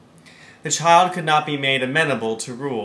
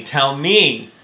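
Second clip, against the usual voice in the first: the repeat said louder, teasing; child teasing her mother, louder the second time; playful repeat; 7 dB louder than usual. A narrator's reading. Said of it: "no longer seriously, but laughing".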